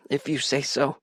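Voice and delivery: put-on small, unsure little-boy voice; unconfident. A narrator's reading. Little brother giving in on the phone, unconfident. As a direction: agree without believing it. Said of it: "He sounded less confident than I felt".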